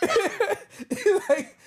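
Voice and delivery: high, loud, helpless laughing; laughing hard while talking; cracking up. Real, not acted. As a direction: squeeze the words out through laughter. Cracking up at the retold lesson.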